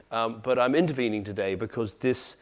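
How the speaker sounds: ordinary talk, nothing marked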